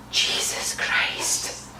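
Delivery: slow exasperated whisper. Real, not acted. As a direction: breathe it out in disbelief. Exasperated aside.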